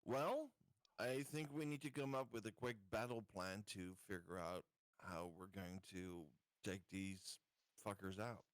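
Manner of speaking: ordinary delivery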